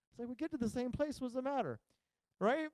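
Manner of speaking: ordinary talk, nothing marked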